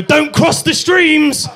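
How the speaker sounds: high, slow, loud warning; mock movie shout. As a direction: yell it like the film line. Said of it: "like Ghostbusters".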